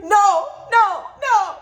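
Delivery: high shouted no, no, no in panic; panicked no. Retold or imitated. Vlogger reliving the missed boarding, panicked high shout. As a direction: cry out in disbelief as the door shuts.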